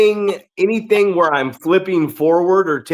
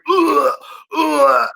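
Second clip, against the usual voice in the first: imitated retching groans; 12 semitones higher than usual. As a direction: imitate a kid puking behind a dumpster. And I hear.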